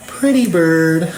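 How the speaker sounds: put-on high, slow childlike coo; blind kid voice. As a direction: coo it dreamily to a pet bird. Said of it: "The blind kid in the wheelchair petting the dead bird".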